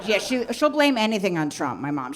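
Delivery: ordinary talk, nothing marked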